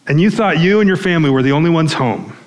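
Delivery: ordinary delivery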